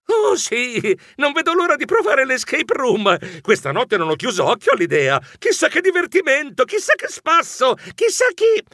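very high, gushing fake excitement; overdone enthusiasm. A narrator's reading. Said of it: "Io allora esclamai".

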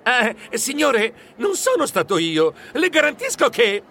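nervous protest. Acted. Geronimo begs the huge rugby player, scared. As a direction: stammer out your innocence. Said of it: "Io tentai di protestare".

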